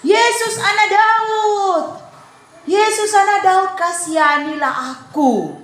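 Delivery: high, stretched cry for mercy; acted loud shout. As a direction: shout out to be noticed by Jesus. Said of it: "dia berteriak dengan keras". (berseru keras minta dikasihani)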